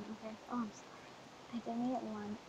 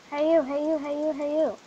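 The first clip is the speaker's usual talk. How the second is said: loud, higher call to a stranger in game; 4 semitones higher, 8 dB louder than usual. Real, not acted. Gamer calling to a stranger in the match, loud hailing. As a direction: hail the other player across the map.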